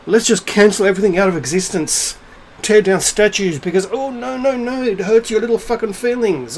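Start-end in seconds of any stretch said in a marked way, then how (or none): 3.9-6.6 high-pitched mocking coo; mock pity. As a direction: sneer at hurt feelings in a high voice. Mocks the easily offended.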